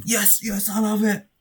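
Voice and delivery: high-pitched mock cry of a player wanting the ball; put-on high, excited shout; imitated player's excited call. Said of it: "didn't say it like that".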